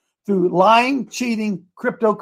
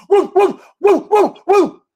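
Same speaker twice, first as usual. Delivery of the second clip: high, loud yipping woo woo; mock dog barking; 11 semitones higher, 6 dB louder, slower than usual. Mocking a congressman barking like a dog. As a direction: bark it out like a yapping dog. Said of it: "they'll let Jim Jordan bark".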